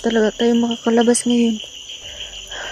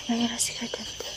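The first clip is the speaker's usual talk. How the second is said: hushed whisper, hiding; 11 dB quieter than usual. Acted. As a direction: whisper so they won't hear. Spotting the creatures, hiding.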